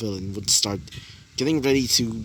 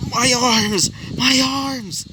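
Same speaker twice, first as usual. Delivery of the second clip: high, loud shriek of pain in a strange voice; pained cry; 11 semitones higher, 3 dB louder, much slower than usual. Acted. Villain shrieks in pain after losing his arms. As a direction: howl at the loss of his arms.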